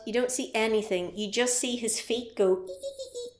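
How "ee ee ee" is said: thin high squeals, very soft; a faint strangled squeal; high squeaks. Retold or imitated. Feet kicking while strangled.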